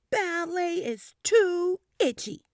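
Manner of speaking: put-on loud child in ballet class. A narrator's reading. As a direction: complain loud enough to stop the piano. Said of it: "But I was very loud".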